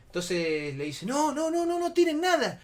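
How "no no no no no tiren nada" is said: high, louder alarmed protest; retold urgent panic; panicked pleading. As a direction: beg them in alarm not to. Friend pleads against stone threat.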